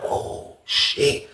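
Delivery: hushed oh shit; whispered gasp. Retold or imitated. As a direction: breathe it out in shock. Whole pod gasps.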